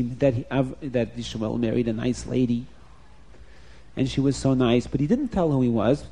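ordinary delivery, nothing marked

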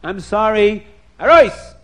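loud furious rant; angry. Acted. Husband throwing his selfish wife out, loud furious yelling. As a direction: throw her out in a rage. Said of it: "he got so angry".

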